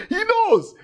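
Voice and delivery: high delighted laugh into the words. Real, not acted. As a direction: laugh with joy then say it.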